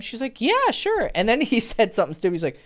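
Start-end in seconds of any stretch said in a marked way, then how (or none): 0.4-1.1 imitating a high-pitched, agreeable yes; keen girl. Coach mimicking a girl accepting a coffee invitation, keen. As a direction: lilt it up high.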